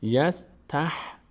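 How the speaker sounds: "یستح-" slowly, ending on a bare h; vowelless H. Shown as a demonstration. Teacher shows the silent-vowel letter, h closed off. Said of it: "ه ساکنه".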